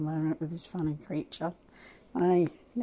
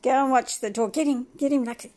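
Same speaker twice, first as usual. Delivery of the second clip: higher, louder urging call; calling out to the dog; 6 semitones higher, 7 dB louder, faster than usual. Real, not acted. Urging Lucky at the door, loud egging-on call. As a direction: egg the dog on.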